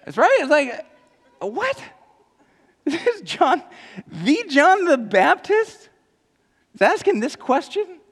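in disbelief; high, loud amazed disbelief; incredulous. Real, not acted. Preacher incredulous at question.

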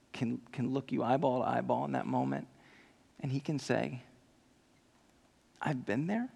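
soft and low, halting and tender; quiet sincere confession to the congregation; hushed preaching. Real, not acted. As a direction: drop almost to a whisper, searching. Hushed turn after climax.

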